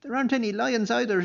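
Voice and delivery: loud, high furious retort; furious shout. A narrator's reading. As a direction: snap it back in a fury. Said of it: "shouted the furious abbot".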